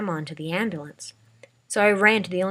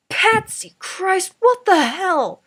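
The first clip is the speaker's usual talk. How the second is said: high, slow shocked outburst; old man yelling in disbelief; shocked shout; 14 semitones higher, 11 dB louder, much slower than usual. A narrator's reading. Grandpa shocked at the bucket.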